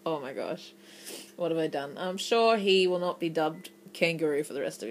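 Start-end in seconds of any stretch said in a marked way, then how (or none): 0.1-2.0 low, hushed mock dismay; imitated quiet dismay. Imitating the embarrassed pupil to himself, quiet dismay. Voices embarrassed boy regretting his name.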